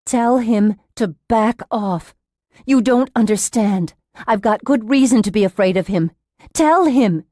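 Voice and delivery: alarmed and insistent; urgent fearful demand. A narrator's reading. Frightened patient demanding nurse intervene.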